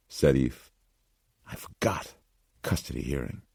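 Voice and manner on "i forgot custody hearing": whispered, much softer, caught off guard; dismayed whisper. Acted. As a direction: breathe it out as the forgotten date hits. Realizes she forgot the hearing.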